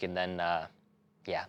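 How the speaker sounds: ordinary talk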